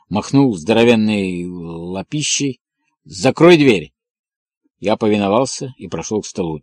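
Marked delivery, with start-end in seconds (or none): 3.0-3.9 higher, louder command; irritated order. A narrator's reading. Irritated colonel ordering reporter to shut the door, sharply.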